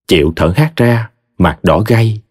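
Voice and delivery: ordinary delivery, nothing marked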